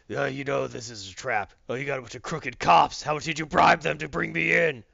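mock-menacing hero tirade; Batman. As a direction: act an accusing dark hero, building in force. Batman accusing Gordon of a trap.

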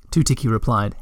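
ordinary delivery, nothing marked

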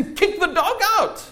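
high-pitched, rousing cry; emphatic exhortation. Real, not acted. Preacher urging Christians to expel the devil, rousing cry.

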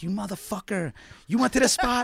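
high, laughing mock outrage at a rival collector; mock yelling rival. Friend yelling at his rival collector, laughing mock fury. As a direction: playfully scream at your friend for beating you to a tape store. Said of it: "we would scream at each other like".

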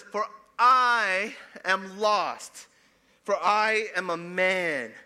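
loud, high, slow declamation; Isaiah's lament. Acted. Prophet confessing he is lost, anguished lament. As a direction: wail the confession out, holding the vowels.

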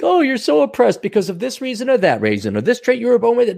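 mocking whine. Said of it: "your complaints like".

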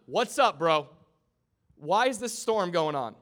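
loud, high volley of questions; urgent interrogation. Acted. Sailors grilling Jonah about the storm, loud and demanding. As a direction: fire each question out as a demand.

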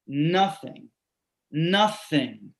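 ordinary talk, nothing marked

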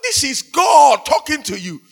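high, loud, indignant mock outburst; imagined anger. Jesus as wrongly imagined, angry at being questioned. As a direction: act out an affronted authority raising his voice. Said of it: "will get angry with him".

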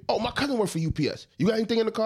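lightly voiced cop, chatty then questioning; retold cop. Driver retells police stop to friends, mock cop.

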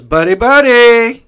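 high, very loud, slow stretched holler; imitated screaming. Shop guy greeting his regular, imitated screaming. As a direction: yell it long, imitating the wild shopkeeper. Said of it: "then he screams at Bo".

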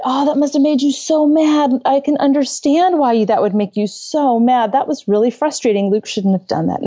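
empathetic. Retold or imitated. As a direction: soothe and validate his anger. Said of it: "I'm soothing his nervous system down".

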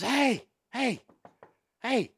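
dad objecting as his son writes the score; imitating dad protesting. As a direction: call out to stop the score being written.

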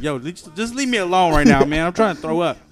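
exasperated retort. Retold or imitated. Telling bathroom onlookers to back off, exasperated. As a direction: imitate your own annoyed protest. Retells snapping at people in bathroom.

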